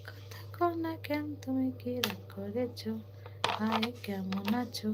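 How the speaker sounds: slow, soft whisper to a pet; hushed, slow whisper coaxing a silent pet to answer. Real, not acted. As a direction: murmur sweetly, slowly, so as not to scare her.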